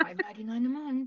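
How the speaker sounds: whispered aside. Real, not acted. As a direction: breathe it as a secret aside. Joking aside about Patreon price.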